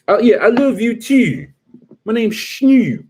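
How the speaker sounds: high, loud mock Baltimore drawl on the vowels; Baltimore accent. Comic showing how they all talk, high Baltimore accent. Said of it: "that's how they all talk".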